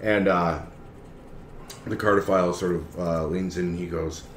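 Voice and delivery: normal talking voice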